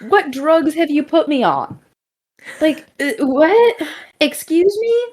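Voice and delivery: very high, squeaky mock disbelief; hammed-up incredulous outburst; flabbergasted. Acted flabbergasted reply to the voice.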